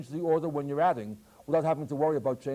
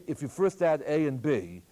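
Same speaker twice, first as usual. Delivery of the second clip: ordinary talk, nothing marked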